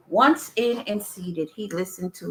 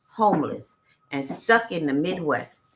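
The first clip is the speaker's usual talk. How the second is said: ordinary talk, nothing marked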